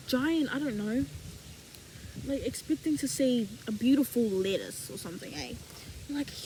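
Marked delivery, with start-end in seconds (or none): none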